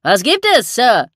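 loud, high shouted question; calling down. A narrator's reading. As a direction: holler the question down from the rigging. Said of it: "rief Pinsley hinunter".

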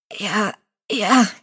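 gasping. Acted. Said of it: "keuchte er".

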